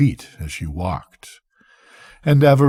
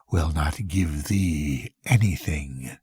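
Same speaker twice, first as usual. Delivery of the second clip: low, slow, softer muttered refusal; 6 semitones lower, 6 dB quieter than usual. Acted. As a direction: mutter it under your breath, lower. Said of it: "she muttered".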